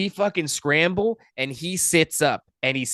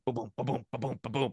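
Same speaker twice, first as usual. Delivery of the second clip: imitated heartbeat; 2 semitones lower, 10 dB quieter, faster than usual. Imitating throbbing pulse in neck.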